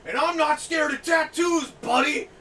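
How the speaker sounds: loud, high, slow macho yell; mock tough-guy shout. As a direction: bark it like a blustering customer. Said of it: "He's like".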